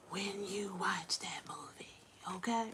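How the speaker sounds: soft unvoiced whisper. Real, not acted. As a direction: whisper it like a secret. Secret aside about the movie.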